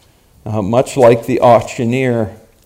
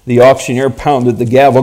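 usual speaking voice

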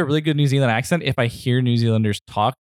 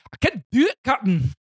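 high-pitched dad's Scottish impression; mock Scottish accent; 10 semitones higher than usual. Copying dad's Star Trek bit, mock Scottish accent. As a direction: strain upward in rough Scottish brogue. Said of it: "the thing that my dad would do".

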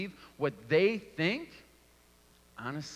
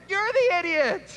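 high, loud yelled comeback; mock angry retort; 6 dB louder than usual. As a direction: shout it back at her, high and loud. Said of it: "And I'm like".

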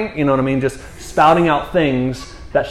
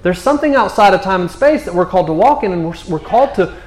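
raised, urgent sermon delivery; impassioned preaching; 4 semitones higher than usual. Real, not acted. Preacher urges congregation to believe, impassioned preaching. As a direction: press the conviction home, line after line.

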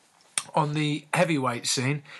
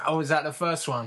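ordinary talk

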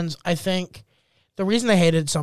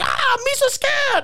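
high, drawn-out mock panic; slow, high-pitched comic fright; scared Jar Jar Binks; slower than usual. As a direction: squeal it like a frightened cartoon sidekick. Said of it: "and he's like".

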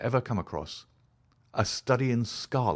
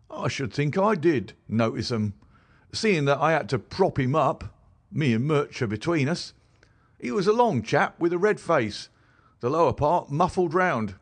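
higher, louder common London constable; a plain-spoken beat policeman telling his tale, pitched up; constable Rance; 7 semitones higher, 4 dB louder than usual. Acted. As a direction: policeman recounting what he found, higher and louder. Rance describes man.

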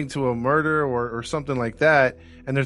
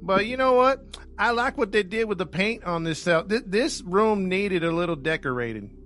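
much higher, hammed-up lazy Southern cop voice; unbothered country sheriff, pitched way up; mock Southern cop; 8 semitones higher than usual. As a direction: play a clueless small-town cop who admires the paint job. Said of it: "the Southern version of U K cops".

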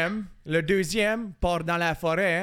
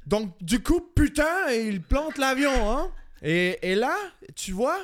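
exaggerated French accent, higher pitched; accent français de France; 6 semitones higher than usual. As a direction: ham up the French accent on the swearing. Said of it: "Ça rajoute des putains". (accent de France exagéré)

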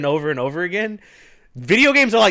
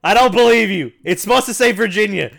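higher, louder playful accusing; loud teasing; 8 semitones higher, 7 dB louder than usual. Real, not acted. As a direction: call out the lie playfully.

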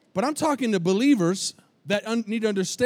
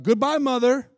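higher, louder call across the store; calling out goodbye; 6 semitones higher, 4 dB louder than usual. Retold or imitated. Man calling after the old lady as she leaves the store, loud call.

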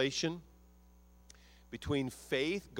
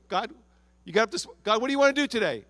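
builds higher and louder to the last question; eager pleading; 6 semitones higher, 6 dB louder, faster than usual. Retold or imitated. Pastor voicing a believer eagerly asking God, higher and louder. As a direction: rise up, asking God with growing urgency. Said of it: "we approach our days this way".

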